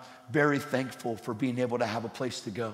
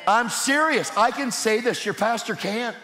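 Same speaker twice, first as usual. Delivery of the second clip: emphatic; 7 semitones higher than usual. Real, not acted. Preacher confronting congregation.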